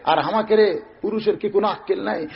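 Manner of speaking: ordinary delivery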